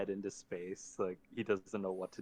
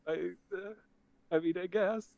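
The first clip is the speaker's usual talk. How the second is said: put-on much higher, panicked young creature; frightened kid voice; 10 semitones higher, 7 dB louder than usual. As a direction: stammer in terror. Mister B voice.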